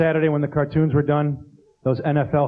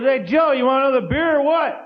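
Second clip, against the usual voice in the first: put-on drunk buddy; 7 semitones higher than usual. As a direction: hail a drunk pal across the bar. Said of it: "his buddy goes".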